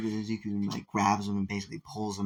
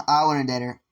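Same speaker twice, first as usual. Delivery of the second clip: louder, laughing through it; laughing declaration; 3 semitones higher, 8 dB louder than usual. Retold or imitated. Guy says again that he wants to date her, louder and laughing. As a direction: blurt it out with a laugh.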